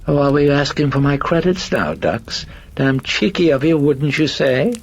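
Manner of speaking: high, loud, mock-offended voice; cheeky personality. Possessing personality teases priest about credits, high and cheeky. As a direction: tease in a piercing high lilt.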